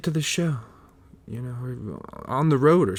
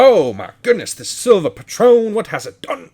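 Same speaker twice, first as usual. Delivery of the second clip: raised, louder gasp of wonder; hammed-up drunken awe; mock amazement; 8 semitones higher, 9 dB louder than usual. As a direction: act dazzled by the tequila, loud and bright at first. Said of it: "And you're like".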